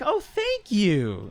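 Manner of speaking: high, stretched-out thank you; strained, drawn-out mock gratitude; forced thanks. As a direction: imitate politeness pushed out slowly. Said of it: "you could tell it's really forced".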